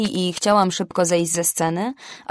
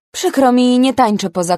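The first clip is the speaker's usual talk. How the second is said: sweet polite refusal, starting louder and higher; as politely as possible; 5 semitones higher, 6 dB louder than usual. A narrator's reading. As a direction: smile the no away as courteously as you can. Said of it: "powtórzyłam najgrzeczniej, jak umiałam".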